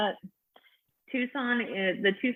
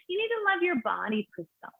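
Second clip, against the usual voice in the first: high-pitched preachy hipster, trailing off softer; earnest hipster lecture in a high voice; 10 semitones higher, 3 dB quieter than usual. Acted. Hipster advising a comic on body love, high and preachy. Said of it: "the hipsters come up to me after".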